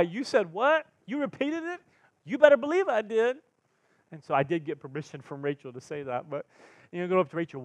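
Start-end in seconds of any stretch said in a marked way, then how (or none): 0.0-3.4 incredulous excitement. Retold or imitated. Retold exchange with wife.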